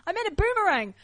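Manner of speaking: high-pitched, stammered correction; flustered backpedal. Retold or imitated. Audience member correcting himself to an offended performer, flustered.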